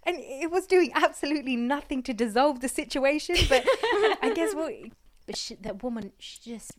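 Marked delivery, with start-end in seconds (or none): 0.0-4.7 high laughing voice while telling; real laughter breaking into the story. Real, not acted. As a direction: giggle through the words, louder and higher.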